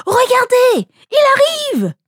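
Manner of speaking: high, urgent lookout call; excited cry. A narrator's reading. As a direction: shout the warning from the ranks. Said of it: "un cri se fit entendre".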